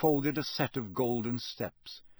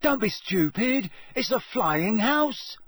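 put-on high-pitched young pupil's retort; high, excited, drawn out at the end; excited schoolboy; 9 semitones higher, 4 dB louder than usual. A narrator's reading. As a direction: pipe up high, contradicting a classmate. First year corrects a shrieking classmate.

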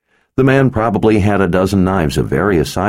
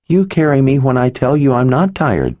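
put-on serious little girl; 9 semitones higher than usual. Little girl to the wizard, high and earnest. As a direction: say it like a solemn small girl. Child reasoning with father.

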